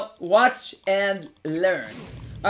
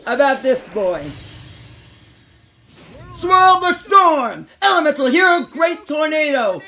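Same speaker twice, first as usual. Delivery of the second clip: hyped shout; 6 semitones higher, 7 dB louder than usual. Acted. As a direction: scream the summon like a hero. Hyped fusion summon callout.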